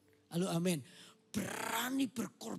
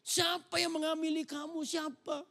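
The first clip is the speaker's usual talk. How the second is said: higher, fast, indignant protest; heated; 7 semitones higher than usual. Acted. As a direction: fire back, getting hot. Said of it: "Yusufnya mulai panas tuh".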